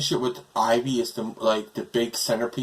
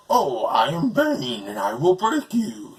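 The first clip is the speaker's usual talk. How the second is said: slow, overdone villain voice; mocking take on Bane, drawn out and theatrical; exaggerated Bane; 3 semitones higher, slower than usual. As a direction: ham up Bane's big threat, slowly. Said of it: "he's over-exaggerated".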